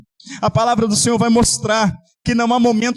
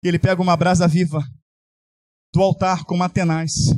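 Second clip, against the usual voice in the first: ordinary talk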